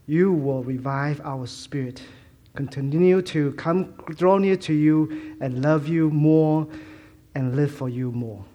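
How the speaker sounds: ordinary talk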